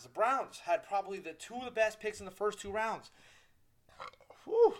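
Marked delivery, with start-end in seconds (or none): 4.4-4.7 loud burp then a breathy whew. Real, not acted. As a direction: let out a big burp. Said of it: "That was a nice burp".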